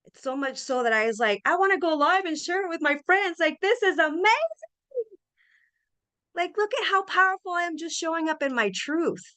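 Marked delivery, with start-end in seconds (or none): 1.4-9.2 higher, bubbling with joy; elated. Retold or imitated. Recalling her own joy at the store, excited. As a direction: let the thrill lift the voice.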